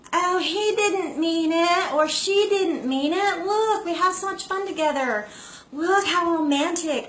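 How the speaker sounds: lightly voiced, dreamy excuse-making; love-struck partner. Partner reassuring herself after love bombing, lightly voiced. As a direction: gush a little like someone swept off their feet. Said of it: "it's so strong that you think".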